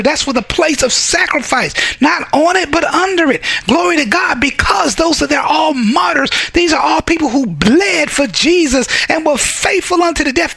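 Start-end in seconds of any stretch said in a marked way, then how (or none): 2.0-10.5 fiery preaching. Real, not acted. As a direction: preach it with fire. Preaching at full force.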